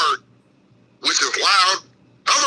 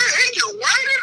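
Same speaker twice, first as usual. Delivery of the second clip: mock whining taunt. Bouncer mocking a crying patron, high whiny shout. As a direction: whine it back at him.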